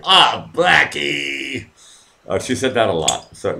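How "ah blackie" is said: long, slow, high "Ah, Blackie"; slow drawn-out mock plea; drawn-out sigh of a name. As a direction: stretch it out like a weary friend. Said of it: "She just said".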